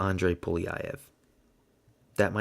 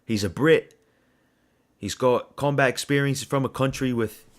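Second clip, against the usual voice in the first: loud, higher-pitched attempt at a British accent; bad British accent; 4 semitones higher, 5 dB louder than usual. Shown as a demonstration. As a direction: have a clumsy go at a British accent. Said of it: "I'm not gonna do that accent".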